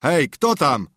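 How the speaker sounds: put-on old man shouting into the dark; calling out. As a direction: hail them from the door. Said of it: "stary i zawołał".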